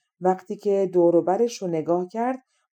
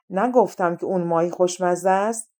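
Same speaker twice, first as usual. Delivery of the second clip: ordinary delivery, nothing marked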